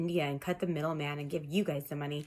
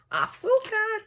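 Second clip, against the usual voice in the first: AZÚCAR sung out very high and louder; celebratory cry; 5 dB louder, slower than usual. Pretend. Cuban tarot reader celebrating sugar, high whooped Azúcar.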